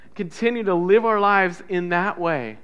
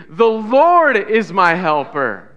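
loud, slow declaration; with conviction; 6 dB louder, slower than usual. Shown as a demonstration. Preacher leading church on count of three, loud conviction. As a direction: proclaim it with full conviction, drawn out. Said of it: "say it with conviction".

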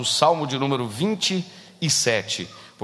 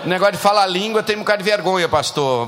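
loud mock complaint; 5 semitones higher, 4 dB louder than usual. Preacher voicing member, loud.

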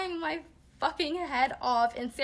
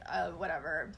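groaning whatever; 5 semitones lower, 6 dB quieter than usual. Retold or imitated. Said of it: "groan and then be like".

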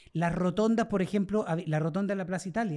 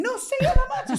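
very high, loud protest; animated retort; 6 dB louder than usual. Real, not acted. Storyteller answering a teasing friend, high loud retort. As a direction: squeal it back, loud and high.

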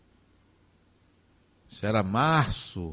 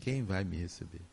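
fading quiet echo; 5 semitones lower, 10 dB quieter than usual. Retold or imitated. Son retelling his dying father's anxious question, softly repeated. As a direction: repeat it much softer and lower, almost to himself.

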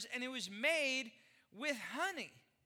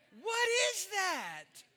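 higher, slow, incredulous; mock disbelief; 6 semitones higher, 4 dB louder, much slower than usual. Pastor to church about Peeps, slow mock disbelief. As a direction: stretch it out baffled.

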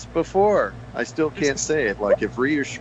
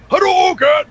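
high, loud fighting game shout; Ryu battle cry; 7 dB louder than usual. Acted. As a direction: yell the special move like Ryu. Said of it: "the voice of Ryu from Street Fighter".

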